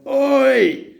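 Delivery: high loud "Oi!"; sharp shout. Retold or imitated. Shouting at the girl with the bottle, high and loud. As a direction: yell to stop her. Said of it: "And I went".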